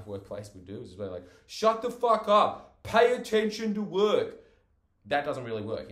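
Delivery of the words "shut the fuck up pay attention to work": slow, high, loud yell; angry boss yelling; furious shout. Acted. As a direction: bellow it at a slacking worker. Acts harsh workplace way of correcting.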